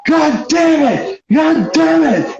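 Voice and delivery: high, stretched-out cursing; hammed-up howl of frustration; mock furious outburst.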